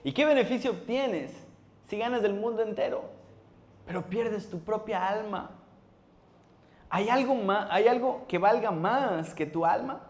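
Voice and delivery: high, ringing preacher's voice, slowing on the soul; fervent scripture reading. Acted. Pastor to his congregation, fervent high proclamation.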